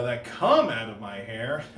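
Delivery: ordinary talk, nothing marked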